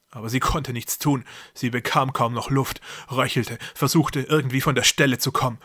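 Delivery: ordinary delivery, nothing marked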